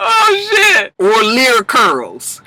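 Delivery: words through real laughter; cracking up at her own pun; laughing. Real, not acted.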